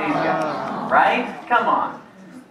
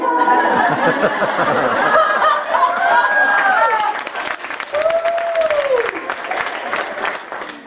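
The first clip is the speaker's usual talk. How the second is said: big goofy laugh. Acted. Commercial clip laugh.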